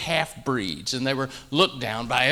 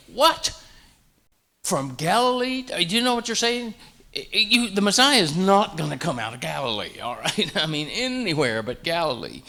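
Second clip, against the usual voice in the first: high, disbelieving outburst, much higher; incredulous scoff; 5 semitones higher than usual. Retold or imitated. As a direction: scoff as if the idea is absurd. Friends scoff at Nicodemus.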